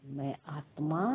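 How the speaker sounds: normal talking voice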